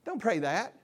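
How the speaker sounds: normal talking voice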